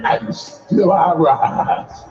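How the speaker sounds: very slow, low, stately recital; Maya Angelou voice again. Shown as a demonstration. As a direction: draw each word out like a poem read aloud. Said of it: "Somebody practice it and say".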